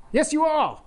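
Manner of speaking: put-on high, insistent little-kid retort; childish insistence. Boy angel insisting to Mary, childish retort. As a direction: squabble back like a playground kid.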